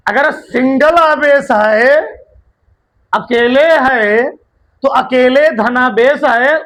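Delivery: slow explaining. Shown as a demonstration. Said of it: "मैं आराम आराम से बोल रहा हूं".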